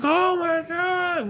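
very high, slow mock happy cry; drawn-out squealed amazement; imitated overjoyed child. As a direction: imitate a kid overwhelmed with joy. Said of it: "fucking cried. He was so happy".

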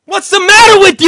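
loud high yell; furious shout. Acted. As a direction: scream it in his face. Acted mob boss shouting.

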